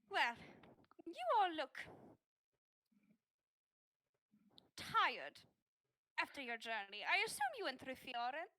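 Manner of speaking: a hint of a grand, welcoming priestess; lightly voiced priestess. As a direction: greet the guests with a touch of ceremony.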